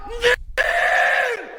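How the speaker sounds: high, furious screaming; furious yelling. Real, not acted. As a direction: scream in disgust. Raging again.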